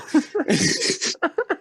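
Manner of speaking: high giggling laugh; cracking up at your own story; real laugh. Real, not acted. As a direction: burst into a high, drawn-out giggle.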